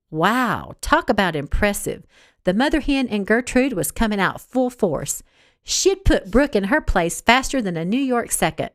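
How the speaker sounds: ordinary delivery, nothing marked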